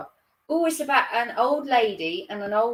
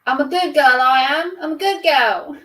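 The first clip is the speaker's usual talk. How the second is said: loud, higher Cockney protest; Eliza Doolittle; 5 semitones higher, 8 dB louder than usual. Acted. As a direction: belt it out as a Cockney flower girl. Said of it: "if I can do a proper Londoner".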